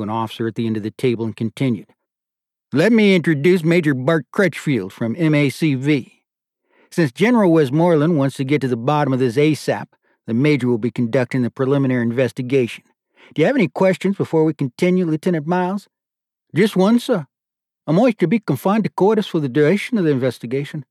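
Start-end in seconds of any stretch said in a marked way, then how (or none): 2.7-15.9 raised, louder formal colonel; stern colonel. A narrator's reading. 16.5-20.8 put-on a light Australian accent, raised and louder; lieutenant answers the board in Australian accent. A narrator's reading. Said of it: "Jiff's accent had a startling effect".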